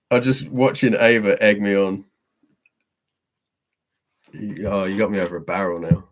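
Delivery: normal talking voice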